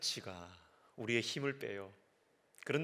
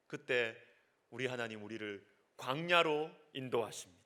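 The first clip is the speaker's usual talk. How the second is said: normal talking voice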